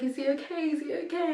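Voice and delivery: worried mother pleading with nurses; anxious, tearful. Real, not acted. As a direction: beg for reassurance. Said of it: "I'm getting emotional now".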